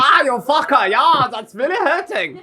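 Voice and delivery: hammed-up agonised yelling; vegetable being carved. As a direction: yelp like a turnip under the knife.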